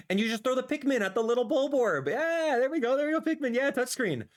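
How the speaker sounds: high, gleeful running commentary; excited cheering. Real, not acted. Streamer watching Pikmin footage, excited cheering.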